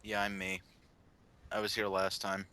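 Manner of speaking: quiet, low mumble; mumbled. Real, not acted. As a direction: mumble it offhand. Mumbled intro.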